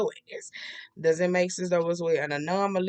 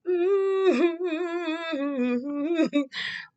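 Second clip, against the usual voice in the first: sung laugh; 10 semitones higher, 3 dB louder than usual. Real, not acted. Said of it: "community, whoever this is".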